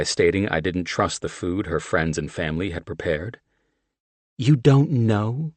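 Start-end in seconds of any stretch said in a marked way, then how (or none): 4.4-5.5 slow, higher mocking echo; mock him, holding back a laugh. A narrator's reading. Mickey mocking John's answer, teasing. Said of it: "she mocked".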